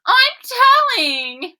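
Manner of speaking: high, slow, loud whine; tattling child. Acted. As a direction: threaten to tell like a little child. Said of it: "Lola cried".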